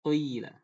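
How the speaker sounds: "Qila" said as "quila", the i rounded toward u; Warsh-style "Qila" leaning to waw. Shown as a demonstration. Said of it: "dibaca condong ke waw".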